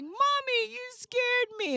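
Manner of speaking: high-pitched mock little kid whine; crying toddler. Scared kid in buggy to mom, imitated crying toddler. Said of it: "and was crying".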